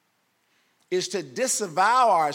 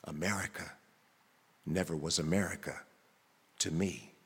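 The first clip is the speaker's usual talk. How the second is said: much lower and softer, withdrawn reply; answering voice; 9 dB quieter than usual. Acted. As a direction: murmur it low and bitter from the shadows. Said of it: "And another voice responds".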